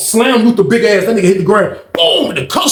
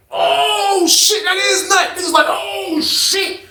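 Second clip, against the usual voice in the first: excited inmates shouting; 13 semitones higher, much slower than usual. Retold or imitated.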